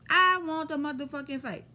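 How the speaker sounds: ordinary delivery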